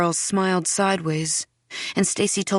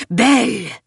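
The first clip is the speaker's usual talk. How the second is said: loud, sharp scolding name; angry hiss; 4 semitones higher, 7 dB louder than usual. A narrator's reading. Mother finding Belle in her closet, angry hiss. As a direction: snap the name higher and louder. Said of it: "she hisses".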